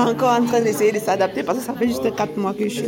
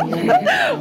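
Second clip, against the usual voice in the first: short high laugh. Real, not acted. Laughs about fixing her accent.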